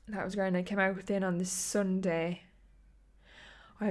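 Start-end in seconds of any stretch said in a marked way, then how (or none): none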